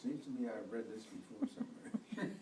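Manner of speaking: ordinary delivery, nothing marked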